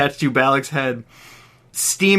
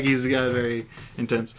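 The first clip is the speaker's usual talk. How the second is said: usual speaking voice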